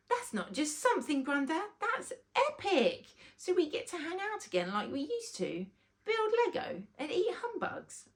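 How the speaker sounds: put-on high, eager child's voice; a boy bursting with excitement; excited. Rory thrilled to hang out with Grandad again, high excited child. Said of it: "asked Rory excitedly".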